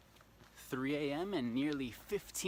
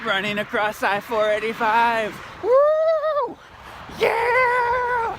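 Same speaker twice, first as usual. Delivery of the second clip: much higher, loud whooping shouts; triumphant yelling; 14 dB louder, slower than usual. Real, not acted. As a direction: yell it out while running. Running across highway, yelling in triumph.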